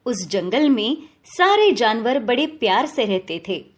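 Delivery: ordinary delivery, nothing marked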